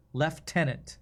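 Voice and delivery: lieutenant said "lef-TEN-ant"; British pronunciation. Shown as a demonstration. Streamer recalling the odd British word, lieutenant as "lef-TEN-ant". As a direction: put an F in lieutenant, "lef-tenant". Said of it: "British people say that's".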